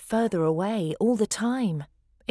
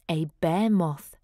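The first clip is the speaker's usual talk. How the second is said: ordinary delivery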